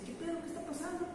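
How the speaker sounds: soft, high-pitched imitated bystander; puzzled mock aside. Man peeking out behind the woman, quietly puzzled. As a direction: ask it small and high, as if peeking out.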